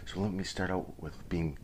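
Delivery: hushed so the wife sleeps; quiet at night. Real, not acted. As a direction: keep it soft, someone's asleep upstairs. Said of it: "I'm gonna be kinda quiet because".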